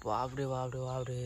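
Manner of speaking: hushed alarm at the snakeskin; scared, quiet. Real, not acted. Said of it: "আমার এত ভয় লেগে গেছে". (ভয়ে আস্তে বলা)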